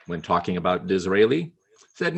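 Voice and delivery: normal talking voice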